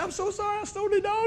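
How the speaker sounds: lady apologizing to the owner, meek and high; sheepish apology. Retold or imitated.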